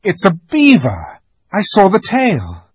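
put-on high, loud eager boy; excited boy. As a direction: blurt it out, thrilled. Peter excitedly identifies the beaver.

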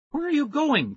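high, anxious call; anxious cry. Acted. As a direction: call out after him. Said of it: "Tara cried".

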